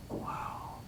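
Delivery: soft unvoiced "Wow."; stunned whisper. Stunned reaction to friend.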